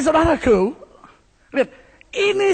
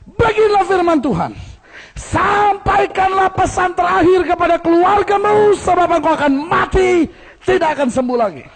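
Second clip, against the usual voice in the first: Isaiah proclaiming, higher and louder; prophet's proclamation; 9 semitones higher, 5 dB louder than usual. Acted. Isaiah announcing death to the king, loud and high. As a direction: deliver God's verdict to the king like a prophet. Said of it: "dengar apa yang dia bilang".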